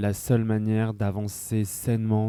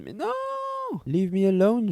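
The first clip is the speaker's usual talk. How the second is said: whiny protest; 12 semitones higher than usual. Retold or imitated. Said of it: "T'es en mode".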